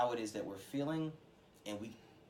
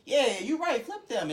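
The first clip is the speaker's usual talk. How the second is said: high, loud, eager agreement; worked-up mock outrage, pitched way up; gassed up; 11 semitones higher, 8 dB louder, slower than usual. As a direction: act out getting swept up in a friend's hype. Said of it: "hyping me up and gassing me up".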